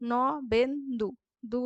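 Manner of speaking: Nabendu broken as ন, বেন, দু; split into syllables. Shown as a demonstration. Teacher isolating the last syllable, word split in three. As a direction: space out the three beats of Nabendu. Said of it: "শেষ দলগুলির মধ্যে".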